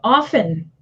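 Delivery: OFTEN said "off-en", no t sound; often with silent T. Shown as a demonstration. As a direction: drop the T in OFTEN. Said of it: "the T is silent".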